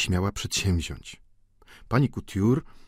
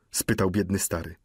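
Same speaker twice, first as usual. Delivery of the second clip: usual speaking voice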